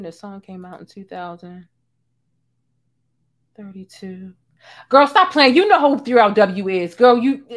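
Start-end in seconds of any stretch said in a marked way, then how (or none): 4.9-7.4 loud, scolding call-out. Real, not acted. Calling out a liar loudly.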